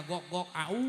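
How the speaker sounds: dog barking. Shown as a demonstration. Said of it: "suara anjing biasa mah".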